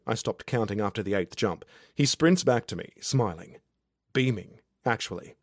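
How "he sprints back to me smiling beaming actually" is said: usual speaking voice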